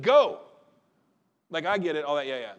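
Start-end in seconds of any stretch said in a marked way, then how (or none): 0.0-0.4 shouted command. Retold or imitated. Repeats the angel's word, louder.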